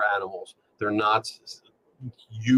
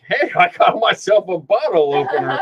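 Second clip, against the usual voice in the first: border guard voice; 9 semitones higher, 7 dB louder than usual. Retold or imitated. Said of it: "some border guard is like".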